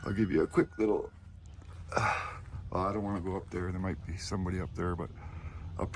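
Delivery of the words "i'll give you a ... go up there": ordinary talk, nothing marked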